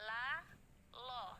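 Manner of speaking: "La" with spread lips then "lo" rounded; thin "La" turning to a thick rounded "lo"; La lo lip demo. Shown as a demonstration. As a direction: slide from a smile to pursed lips on "La, lo". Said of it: "Jadi dari tersenyum kemudian moncong".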